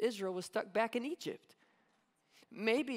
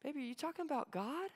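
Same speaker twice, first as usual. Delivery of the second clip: quick, soft, rising realization; dawning mom; 6 semitones higher, 8 dB quieter, faster than usual. Retold or imitated. Mom suddenly realizing her kid means God. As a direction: mom catching on, hushed and fast. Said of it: "She's like".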